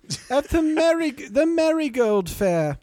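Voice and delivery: very high, slow mock old lady; old woman voice. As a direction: keep hamming the garden granny. Continuing the old woman bit.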